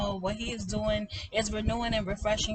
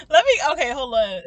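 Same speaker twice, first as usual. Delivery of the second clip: loud; 5 semitones higher, 16 dB louder than usual. Real, not acted. Recovering from laughing fit.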